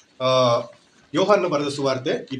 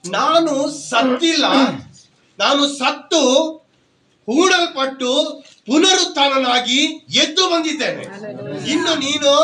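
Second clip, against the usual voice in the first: higher and louder, building preacher's cry; fiery preaching; 9 semitones higher, 7 dB louder than usual. Acted. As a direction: proclaim it over the weeping woman. Preaching as Jesus risen. (ಉತ್ಸಾಹದ ಪ್ರವಚನ)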